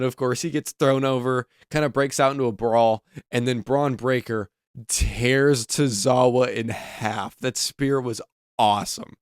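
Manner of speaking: ordinary talk, nothing marked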